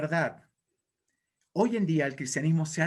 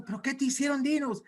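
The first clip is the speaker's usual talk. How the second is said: high, hammed-up questioning; concerned demon minions; 8 semitones higher than usual. Demons press Satan for answers, high fussing voice. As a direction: play an underling coaxing the upset boss. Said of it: "llegó todo su séquito de demonios".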